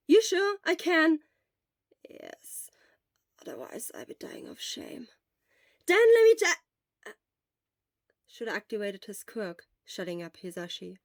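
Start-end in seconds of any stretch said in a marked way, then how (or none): 0.1-1.2 loud, quick, eager friend; loud, urgent friend's voice; Hisashi, loud. Acted. 2.1-5.1 weak whisper; barely a breath, worn out; whispered from a sickbed. Acted. Sick Shura whispers throughout. 5.9-7.1 loud, drawn out, then cut. Acted. Hisashi cut off mid-word.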